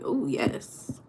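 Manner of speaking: soft breathy inner glee; whispered ooh yes. Retold or imitated. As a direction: whisper it to yourself excitedly. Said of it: "I'm like".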